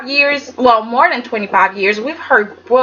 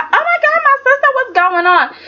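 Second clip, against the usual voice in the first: much higher, fast panicked squeal of a sister; hammed-up gasp from a worried sister; mock alarmed Brooke; 13 semitones higher, faster than usual. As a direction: shriek in fake shock like Brooke. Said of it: "Brooke was just like".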